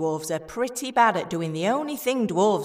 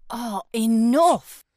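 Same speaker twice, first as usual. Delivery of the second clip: exasperated; 3 semitones higher, 5 dB louder, slower than usual. Acted. Fed up with companions fussing.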